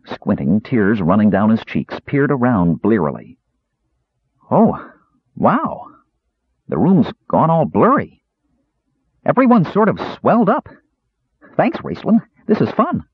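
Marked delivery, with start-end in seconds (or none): 4.5-13.0 high, gleeful kender voice; giddy high kender, pleased; delighted kender. A narrator's reading. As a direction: marvel at the blurry room like a child. Kender delights in blurry view.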